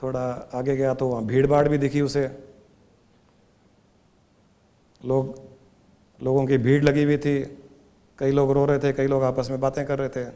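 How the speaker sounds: hushed, measured storyteller; story narration. Acted. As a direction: tell the story quietly and evenly, with long pauses. Tells a parable.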